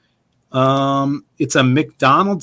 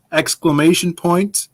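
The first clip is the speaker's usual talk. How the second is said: ordinary talk, nothing marked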